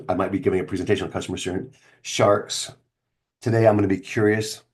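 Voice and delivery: ordinary talk, nothing marked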